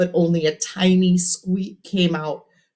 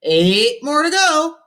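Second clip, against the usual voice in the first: high, slow showy radio host; 12 semitones higher, 5 dB louder, slower than usual. A narrator's reading. Cousin Ralphie answering the caller, showy radio DJ. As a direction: sell it like a DJ on air.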